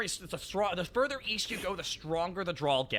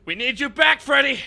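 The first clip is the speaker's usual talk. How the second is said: loud, raised-pitch movie plea; urgent recruiter; 6 semitones higher, 11 dB louder than usual. Retold or imitated. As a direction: call him back with mock drama. Acts the film's recruiting scene.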